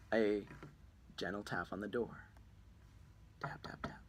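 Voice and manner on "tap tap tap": softly whispered knocking sound; whispered tapping. Acted. Gentle tap on the door at night, whispered. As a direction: whisper the taps lightly.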